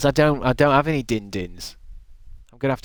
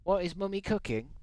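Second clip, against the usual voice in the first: put-on higher, soft child-like ask; lightly babyish question; 6 semitones higher, 8 dB quieter than usual. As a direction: coo it like a little kid. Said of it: "Don't say it like that".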